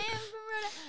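put-on playful Rhode Island accent; mock Rhode Island accent. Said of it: "that right there was [name]".

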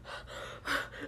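sharp theatrical gasp; dramatic gasp. Acted. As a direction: gasp in mock longing. Sudden needy outburst about touch.

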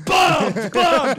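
very high, loud shouted verdicts; taunting yell. Retold or imitated. Heckler grading each comic with a shout. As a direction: shout the judgement gleefully. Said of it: "Goes to Denver Sproul and goes".

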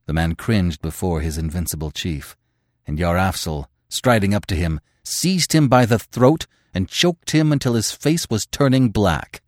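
plain reading voice

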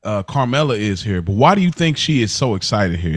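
ordinary delivery

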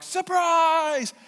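surprise shout. Retold or imitated. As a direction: burst out like the lights just flicked on. Crowd springs surprise party.

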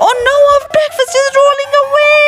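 put-on very high wailing children; children crying out. A narrator's reading. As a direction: wail in dismay like little kids. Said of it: "cried the little children".

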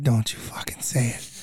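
hissed whisper under her breath; whispered warning. Retold or imitated. Wife whispering to husband at dinner, urgent whisper. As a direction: warn him in a whisper across the table.

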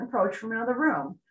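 usual speaking voice

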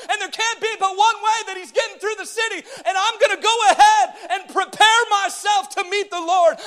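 high, loud preacher's shout in character; Zacchaeus. Acted. Preacher voicing Zacchaeus to the congregation, high full-force shout. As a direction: shout it out as an eager little man. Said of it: "Zacchaeus said".

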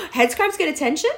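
high gasping mock surprise at the idea; hammed-up shocked pick-me girl. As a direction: gasp like you just discovered a trend.

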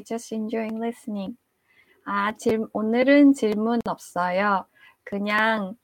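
ordinary talk, nothing marked

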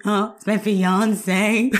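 slow, high mock-tearful answer; flipped out. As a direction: whimper it out, drawing the words long. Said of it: "I was so, like, fucking flipped out".

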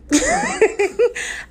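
high squealing laugh. Real, not acted. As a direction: burst into a giggle. Laughing after kiss-off.

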